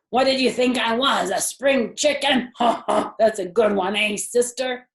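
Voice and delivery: a hammy big pig cracking a weak joke; boisterous, laughing hog; big hearty voice. A narrator's reading. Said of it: "in a big, hearty voice".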